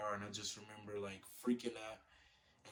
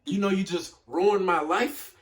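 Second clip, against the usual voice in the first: retold meltdown; 3 semitones higher, 10 dB louder than usual. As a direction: re-enact cutting her off, escalating to a shout. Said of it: "like, an instant meltdown".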